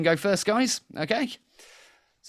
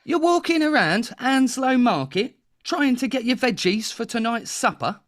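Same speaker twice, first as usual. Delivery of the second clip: high, animated Cockney market patter; bad Cockney TV-advert voice; terrible Cockney accent; 5 semitones higher than usual. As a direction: pitch it up like a hammed-up Cockney salesman. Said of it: "that was my terrible Cockney accent".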